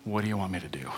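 soft, trembling plea; awed and shaken. Pretend. Saul asking the Lord, a soft trembling plea. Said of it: "he's trembling and astonished".

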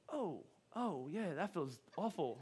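very soft, hammed-up wincing relish; mock passive suffering. Man imagining staying among the bees, mock-placid murmur. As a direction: murmur it as if idly enduring stings. Mocking someone staying in pain.

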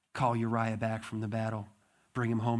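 usual speaking voice

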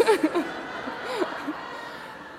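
breathy laughter at her own joke; airy laugh. Real, not acted. Preacher laughing at her own joke, breathy laugh.

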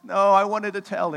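high, loud mock bawling; imitated crying child. Daughter kicked by brother, mock crying. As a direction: bawl like a kid who just got kicked. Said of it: "They're crying".

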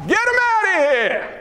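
hammed-up shooing yell; disciples' shout. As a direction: holler it like someone clearing a room. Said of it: "and the disciples said".

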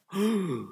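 a sharp shocked gasp of the crowd; audible gasp. Acted. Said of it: "loudest audible gasp that you've ever heard".

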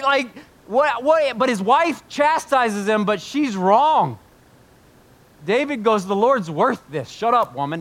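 full-force sermon, building in pitch; impassioned preaching. Acted. Preacher defending David's worship to the church, impassioned. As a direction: preach it high and loud.

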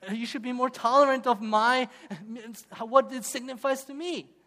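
half-laughing mock plea; laughing husband. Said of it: "the husband looks at his wife and says".

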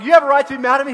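high, loud disbelieving parent; indignant mum or dad, at full volume; exasperated parent. Acted. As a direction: parent scolding a sulking child in disbelief. Parent scolding kid.

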